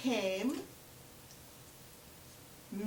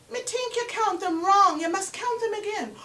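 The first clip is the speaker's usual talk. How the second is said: 1 pushy Anansi; 7 semitones higher, 5 dB louder than usual. Acted. Anansi claims she counted wrong.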